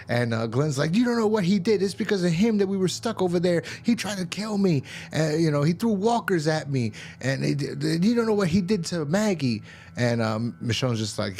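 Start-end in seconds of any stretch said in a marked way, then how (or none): 0.9-9.6 high-pitched, indignant impersonation; hammed-up frantic protest in a high voice; mock outraged arguing. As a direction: imitate someone pleading their grievance, pitch way up. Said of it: "everyone's bickering and screaming at each other".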